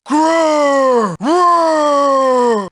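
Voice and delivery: long, drawn-out "grrr"; brutish growl. Acted. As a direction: growl like a big brute. Bully growls between lines.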